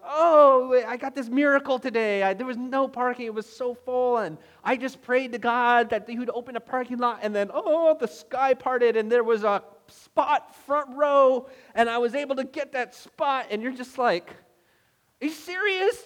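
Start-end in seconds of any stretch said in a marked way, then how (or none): 0.1-13.5 louder, high-pitched breathless testimony of a miracle; gushing friend. Acted. Friend bragging to buddies about a parking miracle, gushing. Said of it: "your friends talk about". 15.2-16.0 very high, loud disbelief; incredulous. Acted. Annoyed listener to friend boasting of parking, incredulous.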